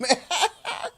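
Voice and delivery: high burst of laughter. Real, not acted. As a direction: giggle up high. Laughing at her own hiccups.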